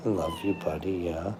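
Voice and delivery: tender murmur to a pet. Real, not acted. Streamer quietly cooing to her cat, tender. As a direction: tell the cat you love it, barely above a breath.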